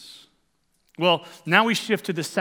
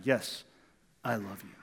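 much softer, a quiet confession; soft humble reply; 8 semitones lower, 11 dB quieter than usual. Acted. Preacher voicing Peter answering Jesus, soft and humble. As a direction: let it fall away gently.

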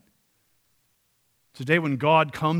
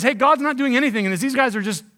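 scoffing skeptic; 7 semitones higher, 6 dB louder than usual. Retold or imitated. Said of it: "The skeptical answer is".

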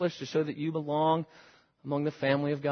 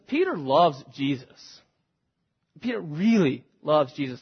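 normal talking voice

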